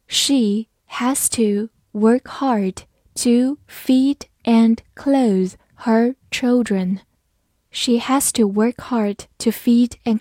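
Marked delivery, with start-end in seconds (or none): 0.1-7.0 very slow, deliberate reading aloud. Shown as a demonstration. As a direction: read "She has to work hard" slowly for learners. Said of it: "跟着我慢读一遍". (慢读)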